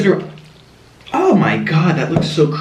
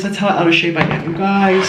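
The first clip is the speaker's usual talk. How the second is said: normal talking voice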